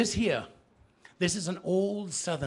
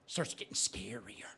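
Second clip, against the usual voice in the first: spooky storytelling whisper; 6 semitones lower, 6 dB quieter than usual. Acted. Storyteller scaring the audience with a hushed swamp tale. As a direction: draw them in with a creepy hush.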